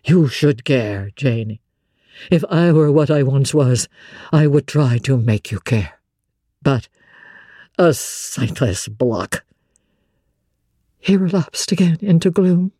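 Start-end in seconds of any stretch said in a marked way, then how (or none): 0.0-9.4 despairing Rochester. Acted. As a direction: let the last words fall heavily. Narrator voices Rochester lower.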